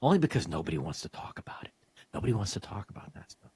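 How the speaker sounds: hushed conspiratorial whisper. Real, not acted. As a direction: drop to a secret whisper. Conspiratorial aside about taboo topic.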